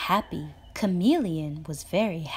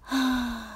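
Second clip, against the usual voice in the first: sigh of relief; 7 semitones higher than usual. Acted. Chameleon relieved after the rain, sigh. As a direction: let out the tension. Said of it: "breathed a sigh of relief".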